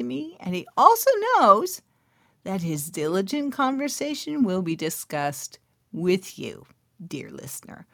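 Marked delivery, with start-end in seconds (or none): 6.4-7.9 intimate whisper to the listener; hushed confiding whisper. Real, not acted. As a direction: lean in and whisper it.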